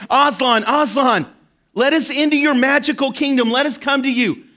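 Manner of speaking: Aslan's name called high and loud, pleading; calling out. Acted. Children calling out to Aslan for entry, loud and pleading. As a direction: call across a distance to the lion.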